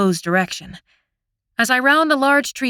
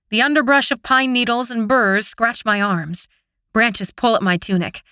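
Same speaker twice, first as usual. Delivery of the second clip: plain reading voice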